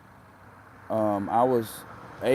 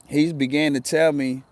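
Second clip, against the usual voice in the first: usual speaking voice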